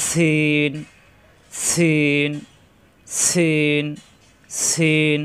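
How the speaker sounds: সিন with a strong hissed s and long vowel; firm whistling s. Shown as a demonstration. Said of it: "জিহ্বা নিচের দাঁতে চাপ দিয়ে কিন্তু শক্ত".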